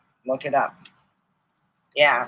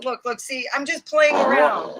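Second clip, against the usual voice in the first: pleading; 9 semitones higher, 4 dB louder than usual. Retold or imitated. Pleading with angry old lady.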